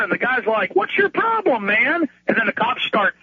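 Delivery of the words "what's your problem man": pitched way up, imitating an indignant guy; mock exasperated protest. EMT challenging the cop who stops him, mock exasperation. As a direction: act out an incredulous challenge.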